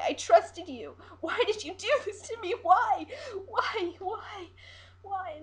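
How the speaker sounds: high, loud, anguished cries of betrayal; shouting and crying. Acted. Siren screaming at the captain who betrayed her, high and loud. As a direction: wail at them, broken and loud. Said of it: "She begins to shout and cry".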